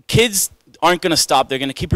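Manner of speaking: normal talking voice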